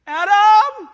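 full loud high shout of the name. Acted. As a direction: bellow it across the garden. God shouting Adam's name.

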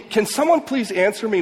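normal talking voice

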